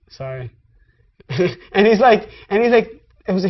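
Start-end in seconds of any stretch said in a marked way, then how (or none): 0.1-0.5 meek apology. Retold or imitated.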